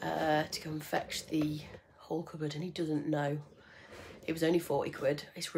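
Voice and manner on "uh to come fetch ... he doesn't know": hushed secret whisper. Real, not acted. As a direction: whisper it so he won't hear. Secret from partner, whispered aside.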